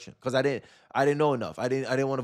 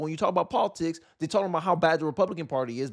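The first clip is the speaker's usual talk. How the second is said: ordinary talk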